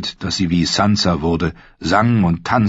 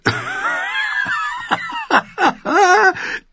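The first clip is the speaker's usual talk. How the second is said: high, loud burst of laughter. A narrator's reading. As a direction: let out a big roaring laugh. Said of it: "Gendry lachte lauthals".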